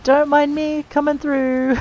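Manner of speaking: slow, high, playful and loud; playful sing-song. Acted. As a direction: sing it out cheekily while leaping past. Jumping down the falls.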